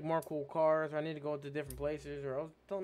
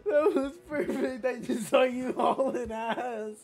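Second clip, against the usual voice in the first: high, loud words through laughter; helpless laughter; 11 semitones higher, 8 dB louder than usual. Real, not acted. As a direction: gasp it out while cracking up. Cracking up at perfect game moment.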